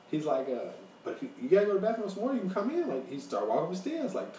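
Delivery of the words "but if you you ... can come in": high, fast, insistent coaxing; creepy invitation. Retold or imitated. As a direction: imitate a stranger urging someone inside. Acting the man's insistent invitation.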